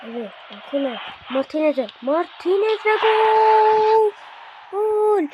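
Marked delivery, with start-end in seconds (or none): 2.0-5.2 high, drawn-out goal call; goal shout. Acted. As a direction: yell the hat-trick. Hat-trick goal commentary.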